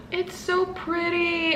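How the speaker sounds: soft, high, drawn-out coo; awed cooing. Real, not acted. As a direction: swoon over it softly. Overcome by the pretty pin.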